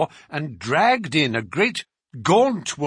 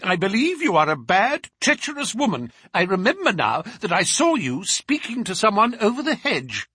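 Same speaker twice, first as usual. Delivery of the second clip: raised-voice reproach, higher pitched; cried accusation; 7 semitones higher, 3 dB louder than usual. A narrator's reading. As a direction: accuse the servant in a high, indignant cry. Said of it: "cried her mistress".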